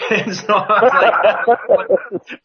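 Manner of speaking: laughing through the words; can't stop laughing as he says it; breaking into laughter mid-sentence. Real, not acted. Laughing while retelling.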